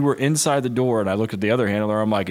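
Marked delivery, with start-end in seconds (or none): 0.0-1.0 incredulous. Retold or imitated. Reenacts disbelief at handler's mistake.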